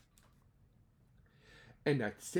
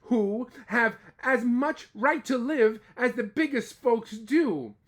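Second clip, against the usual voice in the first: mild begging; 6 semitones higher, 8 dB louder than usual. Acted. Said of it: "and begged".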